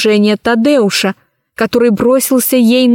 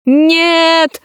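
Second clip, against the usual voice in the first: high, sharp cry of denial; usurper's howl; 6 semitones higher than usual. A narrator's reading. Unmasked usurper howls at the empress. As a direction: howl it out in panic. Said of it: "завопил узурпатор".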